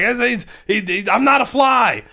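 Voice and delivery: high, stammering shout; hammed-up panic; panicked protest. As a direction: yell in panic at the spider. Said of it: "He's like".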